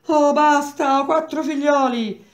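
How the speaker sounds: high, loud begging cry; desperate plea. Acted. Olindo pleading for mercy under the blows, high and desperate. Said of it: "i lamenti di Olindo".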